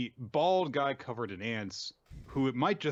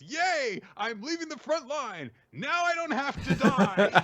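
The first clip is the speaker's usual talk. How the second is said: much higher, jumping-for-joy squeal that runs slow and loud at the end; gleeful cheer; 6 dB louder than usual. Retold or imitated. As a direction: cheer like a coward let off the front line. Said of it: "I do love him being so excited".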